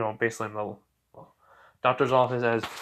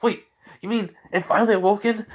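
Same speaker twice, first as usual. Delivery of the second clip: high-pitched, acted disbelief; startled, rising question; surprised; 9 semitones higher than usual. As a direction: play an astonished mother checking the news. Mother reacts to big news.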